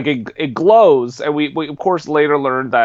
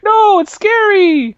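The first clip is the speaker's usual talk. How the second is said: high, slow frightened squeal; mock scared woman; 6 dB louder, slower than usual. Woman on subway quoted.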